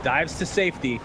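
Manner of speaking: high-pitched, urgent esports commentary; excited play-by-play. Real, not acted. Caster calling a Phoenix escape to viewers, excited high-pitched play-by-play. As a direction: call the fight live, surging high on the big hit.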